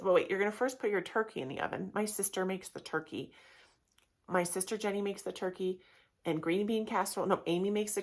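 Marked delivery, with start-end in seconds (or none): none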